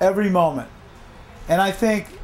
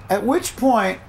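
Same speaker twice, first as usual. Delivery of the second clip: usual speaking voice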